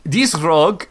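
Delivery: put-on high, loud European accent with rolled R; failed German accent, rolling R. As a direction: roll the R on "rogue" in a European accent. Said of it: "without rolling the R".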